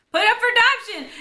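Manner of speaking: high, very loud exclamation with laughter around it; mock outburst. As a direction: blurt it out in a hammed-up shout. Acted sisters' quarrel, teller laughing.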